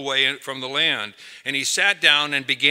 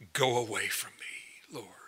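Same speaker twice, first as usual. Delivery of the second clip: hushed, shamed whisper; whispered plea; 3 semitones lower, 8 dB quieter than usual. Retold or imitated. Peter at Jesus' feet whispering in shame. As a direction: whisper it, overwhelmed and ashamed. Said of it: "and said".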